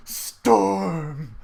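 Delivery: drawn-out, restrained mock scream; dramatic scream. Hero calls out to the heavens, mild theatrical scream. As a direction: cry the name to the heavens, held back. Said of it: "I scream to the heavens".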